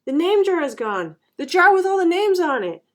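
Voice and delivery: high, loud shouted report; alarmed shout. A narrator's reading. Ralph telling the teacher of the missing jar, shouting. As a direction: shout it out, pitch and volume jumping. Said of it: "Ralph shouted at him".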